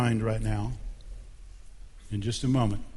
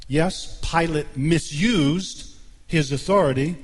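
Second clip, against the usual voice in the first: ordinary talk, nothing marked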